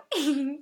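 short high haughty laugh; brief self-satisfied giggle; smug chuckle. A narrator's reading. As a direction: chuckle with superiority. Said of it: "lachte er überheblich".

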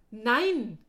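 sharp loud cry of refusal. Acted. Darren refusing to leave his friend behind, shouting. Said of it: "rief Darren aus".

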